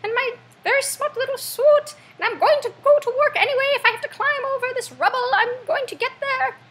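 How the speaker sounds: very high, mock-British character voice; plucky British citizen. British after a night of bombing, imitated as plucky and high-pitched. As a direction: chirp it with determined stiff upper lip. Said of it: "they're like".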